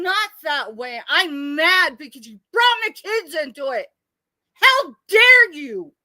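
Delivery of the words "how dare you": furious. Real, not acted. Furious confrontation about her kids.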